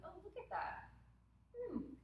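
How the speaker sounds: high, light voice of mild wonder; curious observer. Retold or imitated. Stepping outside to watch the self, lightly curious. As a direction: imitate an intrigued onlooker, pitched high.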